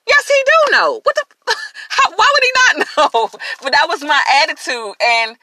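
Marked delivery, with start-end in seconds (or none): 0.1-3.4 incredulous laugh. Real, not acted. Mocking her own past attitude.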